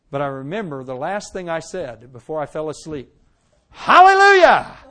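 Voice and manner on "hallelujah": loud, high, slow ecstatic cry; high, loud drawn-out hallelujah; joyful hallelujah. Retold or imitated. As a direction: cry out praise with all your heart. Last words before sleep, rejoicing.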